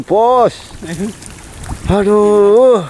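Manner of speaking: high yelled call into gusts; shouting over wind. Real, not acted. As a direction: raise the voice high against wind. Strong wind on the boat.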